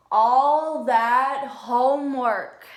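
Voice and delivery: slow, loud, drawn-out groan of disgust; groaning complaint. Acted. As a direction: kid groaning over homework. Worm complains about homework.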